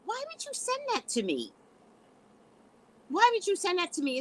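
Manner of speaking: indignant question pitched very high; indignant challenge. Real, not acted. Challenging the man who sent the video, indignant and high-pitched. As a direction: demand an answer, voice shooting up high.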